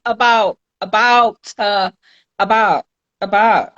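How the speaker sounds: about said with the T dropped; defiant dropped-T about; about without the T. Shown as a demonstration. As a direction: clip the T off about. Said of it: "I don't need to say the T".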